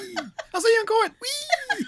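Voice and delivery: high, laughing squeal; squealing with glee. Pretend. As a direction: shriek it down the aisle. Acting the sliding shopper.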